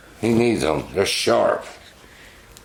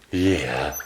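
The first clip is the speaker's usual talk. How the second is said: ordinary talk, nothing marked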